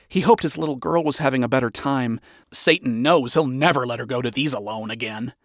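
ordinary delivery, nothing marked

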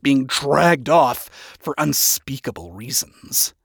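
usual speaking voice

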